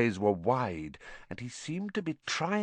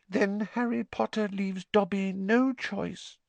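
sad; 10 semitones higher than usual. A narrator's reading. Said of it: "said the elf sadly".

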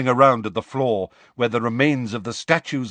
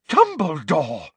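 gasped; 5 semitones higher, slower than usual. Acted. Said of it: "gasped Fudge".

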